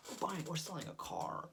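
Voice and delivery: a hint of a suave fifties salesman; Don Draper. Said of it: "I'd love to do a Don Draper voice".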